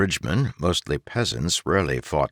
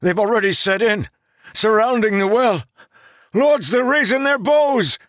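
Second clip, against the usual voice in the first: high, loud scream of horror; horrified scream; 5 dB louder than usual. Acted. Gavin screaming back to Ten about the enemy, horrified. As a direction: scream it in terror. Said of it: "horror in his voice".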